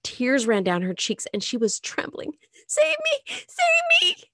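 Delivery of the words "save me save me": high, loud sobbing plea; terrified crying plea. Acted. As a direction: cry out in nightmare terror. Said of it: "she cried".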